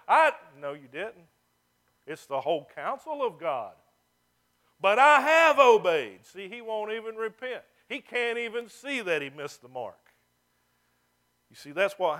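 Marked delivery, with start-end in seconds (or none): 4.8-6.2 loud, slow, stubborn insistence; defiant Saul denying his failure; indignant protest. Retold or imitated. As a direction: hammer it home, refusing to budge. Saul's defiant protest repeated.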